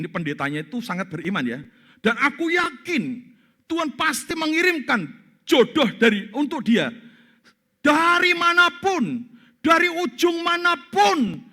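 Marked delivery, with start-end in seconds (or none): 2.0-11.5 much higher, louder, slow rising prayer; fervent prayer. Retold or imitated. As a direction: pray with mounting faith, calling out. Pastor prays fervently, laying on hands. (pendeta berdoa berapi-api, keras dan tinggi)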